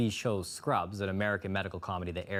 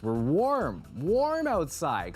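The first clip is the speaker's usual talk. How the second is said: gushing about the warmth; 5 semitones higher, 4 dB louder, much slower than usual. Real, not acted. Host enthuses about weather.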